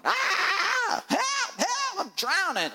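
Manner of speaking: high-pitched mock cry for help; hammed-up panicked shriek; panicked hollering. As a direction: holler like you're drowning. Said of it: "we're hollering".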